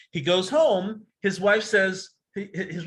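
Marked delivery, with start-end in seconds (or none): none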